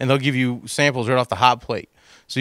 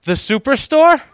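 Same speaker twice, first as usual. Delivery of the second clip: riffing; 10 semitones higher, 4 dB louder than usual. Acted. Riffing on shopping absurdly.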